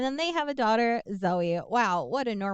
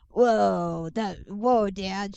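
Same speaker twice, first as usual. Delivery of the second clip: put-on teen drawl; much slower than usual. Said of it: "She talks like that".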